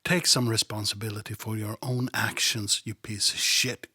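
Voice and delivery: usual speaking voice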